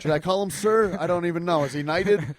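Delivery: high, loud star-struck questions; excited gushing. Acted. Podcast host gushing over an English gentleman, high and excited. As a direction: gush like a starstruck fan.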